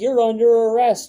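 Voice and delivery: high, loud, slow barked order; cop's arrest order. Retold or imitated. Cop telling the speaker he is under arrest, loud and slow. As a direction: play the cop announcing the arrest. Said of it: "And they're like".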